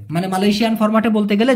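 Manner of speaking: ordinary delivery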